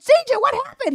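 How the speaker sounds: high, retold cry of alarm; high-pitched urgent question; alarmed mother.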